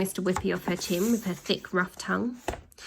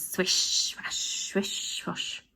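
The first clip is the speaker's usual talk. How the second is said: swish; 5 dB quieter, much slower than usual. A narrator's reading. Wiper sound effect.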